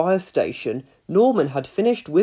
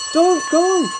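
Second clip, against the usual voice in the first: pleading mum; 12 semitones higher, 4 dB louder than usual. A narrator's reading.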